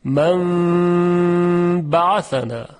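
من بعثنا chanted slowly, n turned to a held nasal m; Quranic recitation. Shown as a demonstration.

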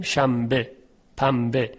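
شنبه and پنبه said quickly as shambe, pambe, m not held; everyday Persian m, not held; quick plain m. Shown as a demonstration. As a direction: say شنبه پنبه with a short m and move on.

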